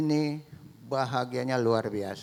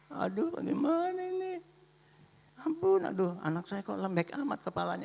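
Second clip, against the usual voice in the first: keluhan ibu yang cemas, meninggi di awal lalu melirih; 9 semitones higher, 5 dB quieter than usual. Acted. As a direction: mengeluh bingung dengan nada tinggi, pelan. Ibu panik melihat bayinya. (ibu cemas mengeluh)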